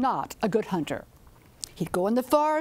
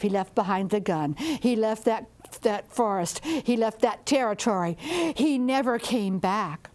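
ordinary delivery

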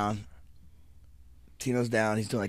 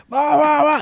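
mock rage; 8 dB louder than usual. Imitating flipping out.

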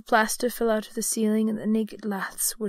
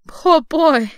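raised, loud exclamation of pity; pitying cry; 7 semitones higher, 12 dB louder than usual. A narrator's reading. Scrooge pitying his former self, crying out. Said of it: "in pity for his former self".

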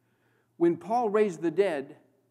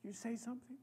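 almost whispered, much softer; near-whisper; 4 semitones higher, 16 dB quieter, faster than usual. Real, not acted. Preacher urging the congregation softly, almost a whisper. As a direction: hush it to the crowd. Tender close after emotion.

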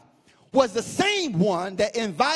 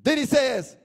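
normal talking voice

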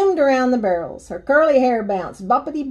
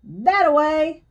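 mild, higher-pitched read-aloud yell; yelled encouragement; 5 semitones higher, 3 dB louder, slower than usual. A narrator's reading. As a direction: cheer her on, lightly. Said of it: "I yelled".

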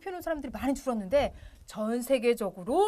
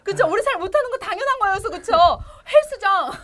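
laughing excitedly; 7 semitones higher, 9 dB louder than usual. Real, not acted.

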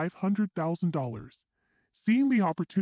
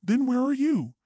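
higher-pitched, mildly agitated question; tinged with panic; 8 semitones higher, 4 dB louder than usual. A narrator's reading. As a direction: demand it with a touch of panic. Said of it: "Panic tinged my husband's voice as he started to speak faster".

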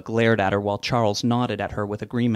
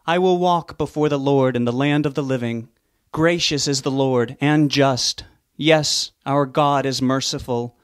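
slow, higher, measured psalm recital; solemn scripture reading; 4 semitones higher, 3 dB louder than usual. A narrator's reading. Officiant reading Psalm 116 to the mourners, solemn and slow. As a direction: read it like a minister at a funeral.

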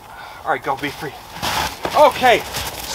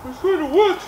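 voicing the dog; 10 semitones higher than usual. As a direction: voice the dog's doubt, a little higher. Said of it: "He's like".

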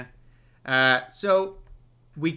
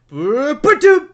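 loud, higher, slow imitated thud; house landing noise; 10 semitones higher, 12 dB louder, slower than usual. Describing the house landing in Oz, loud imitated thud. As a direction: boom it out like a house hitting ground. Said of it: "the whole entire house drops and goes".

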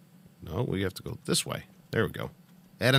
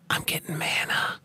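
whispering; 7 semitones higher than usual. Real, not acted. Whispered game remark.

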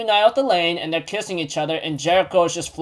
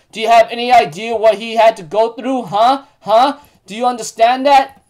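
raised, insistent retold rant; heated scolding; 4 semitones higher, 4 dB louder than usual. Jericho berates Elaine about Bond's sacrifices, heated. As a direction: act out berating a rival, demanding answers.

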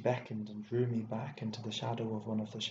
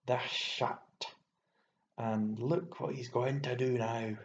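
seething outburst, little raised; contained fury; rage; slower than usual. A narrator's reading. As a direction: spit out the contempt. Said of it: "rose and fell in rage".

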